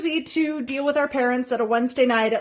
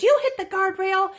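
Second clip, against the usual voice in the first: higher stern mom voice with YOU stressed; imitating mom; 9 semitones higher than usual. Mom confronting her daughter, imitated high and stern. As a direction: play mom pinning the blame. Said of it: "Mom immediately looks at me and goes".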